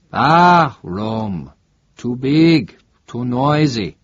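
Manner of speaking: a lightly voiced Italian barman; chatty local in a trattoria; friendly Italian bartender. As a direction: bartender welcoming a tourist. Bartender on Rome.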